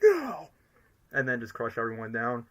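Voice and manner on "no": high, short villain shout; mock shout. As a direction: cry it out high, in mock rage. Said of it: "I expect to shout it by saying".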